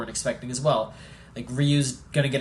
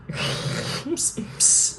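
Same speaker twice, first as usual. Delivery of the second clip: whispered psst; 10 semitones higher than usual. Real, not acted. Shushing S1 during the talk.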